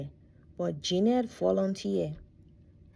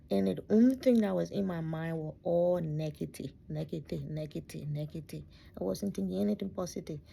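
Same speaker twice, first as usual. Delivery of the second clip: usual speaking voice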